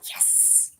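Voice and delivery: whispered yes. Retold or imitated.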